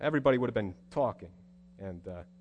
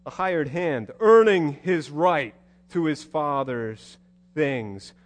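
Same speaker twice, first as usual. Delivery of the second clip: normal talking voice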